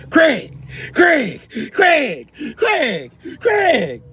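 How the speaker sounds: very high, loud repeated calls; high shrill calling; yelling for Craig. Acted. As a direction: shout his name across the street.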